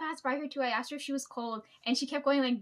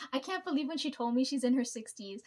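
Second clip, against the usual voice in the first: ordinary delivery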